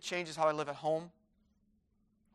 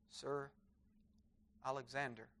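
timid quiet answer; 4 semitones lower, 13 dB quieter than usual. Acted. Terrified soldier answers his king.

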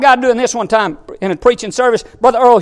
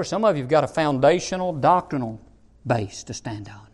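low, soft pulpit aside; quiet, low preacher's delivery; hushed preaching; 9 semitones lower, 7 dB quieter than usual. Real, not acted. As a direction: bring it down low and confiding.

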